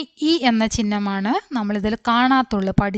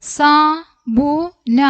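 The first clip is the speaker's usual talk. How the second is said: very slow, higher, separated sounds; letter by letter; 5 semitones higher, 4 dB louder, much slower than usual. Shown as a demonstration. Teacher spelling out a word for learners, letter by letter. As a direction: give each letter its own beat.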